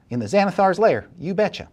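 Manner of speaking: cocky blackmailer. Acted. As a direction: toss it off like a done deal. Same woman.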